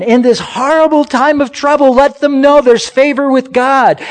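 full-force preaching. Acted. Preacher proclaiming favor with God to his church. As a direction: proclaim it to the whole room.

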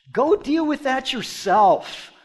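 high, slow mock brush-off; scornful dismissal. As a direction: wave them off as a scornful Roman official. Acts out Rome dismissing the accusers.

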